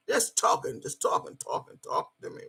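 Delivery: unvoiced aside, fading away; whisper. Real, not acted.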